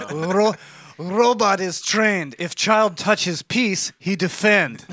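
lightly voiced mock Russian, slow; bad Russian accent. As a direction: play a stern Russian robot trainer badly. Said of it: "who can do the worst Russian accent".

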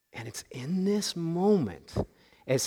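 slow, whispered storytelling; hushed whisper. Acted. Preacher to congregation, drawing them in with a slow whisper. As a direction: lean in and whisper slowly.

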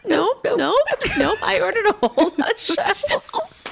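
high, laughing no no no; laughing protest. Retold or imitated. Retold friend, laughing protest.

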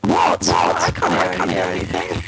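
low doubled, demon-like echo; double echo. Real, not acted. Guest who can't hear her hosts, double echo. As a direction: voice doubled by a glitching line. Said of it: "we've got a double echo on you".

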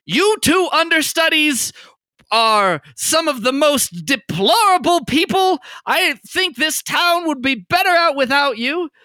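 bard's cutting insult; vicious mockery. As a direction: sneer down at the two understudies. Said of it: "as she uses vicious mockery".

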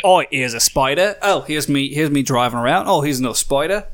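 American pretending to be Australian; fake Australian accent. Host mimicking Australian Snapchatters, fake Aussie accent. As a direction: put on a mock Aussie accent. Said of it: "American pretending to be Australian".

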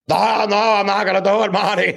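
Doc Brown. Pretend. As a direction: refuse Marty in Doc's voice. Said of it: "just be like".

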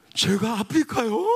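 drawn-out, lightly imitated disbelief; Donghwan's startled question. Young man questions pastor's plan to take him, mildly startled. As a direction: question it slowly, mildly taken aback. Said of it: "제가 똑같이 흉내낼게요".

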